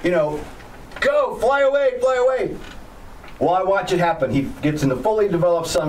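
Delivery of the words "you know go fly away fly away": high, frantic cries at the pilot; panicked yelling. Retold or imitated. Instructor watching his student's helicopter sink, panicked high yelling. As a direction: shout up in panic as the crash unfolds. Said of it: "And I'm going".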